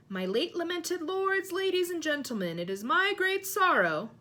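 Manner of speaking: high, loud ceremonial oratory; grand speech. A narrator's reading. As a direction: declaim mournfully to a big hall. Nick begins a formal speech.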